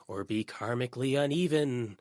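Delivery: playful ghost-story voice; hint of a spooky teller. A narrator's reading. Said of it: "Raquel said in a spooky voice".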